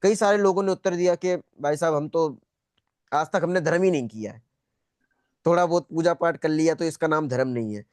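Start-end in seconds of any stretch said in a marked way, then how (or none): none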